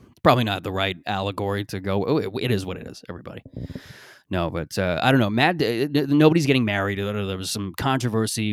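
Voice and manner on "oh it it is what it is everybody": ordinary talk, nothing marked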